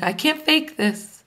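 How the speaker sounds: usual speaking voice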